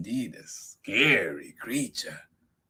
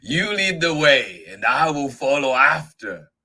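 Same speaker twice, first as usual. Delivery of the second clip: loud monster voice; big storybook beast, humouring the mouse; amused Gruffalo; 7 semitones higher, 11 dB louder than usual. Acted. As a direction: send the mouse ahead, booming. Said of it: "said the Gruffalo, bursting with laughter".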